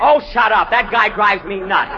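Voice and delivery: loud, irritated comeback; annoyed outburst. Acted. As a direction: snap back at the noisy crew. Star snaps back at the shouter.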